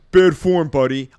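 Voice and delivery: ordinary talk, nothing marked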